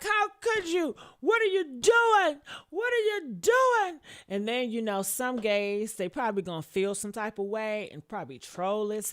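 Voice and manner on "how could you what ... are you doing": high, loud imitated outrage at the singer; a hammed-up furious yell, pitched way up. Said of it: "I know she mad as hell".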